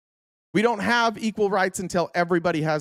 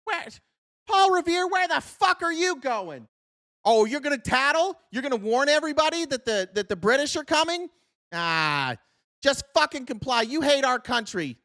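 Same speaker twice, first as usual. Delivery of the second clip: much higher, sneering mock voice; mock Tory scolding; 9 semitones higher than usual. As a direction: berate Paul Revere like a snitch-hating loyalist. Mocking a loyalist yelling at Revere.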